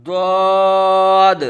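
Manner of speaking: long, loud ضاد with a thick heavy d; full-mouthed Arabic Daad. Shown as a demonstration. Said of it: "اس کو پر پڑھیں گے".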